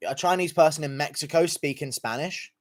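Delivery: normal talking voice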